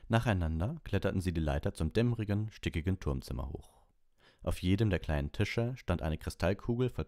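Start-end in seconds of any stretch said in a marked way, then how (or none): none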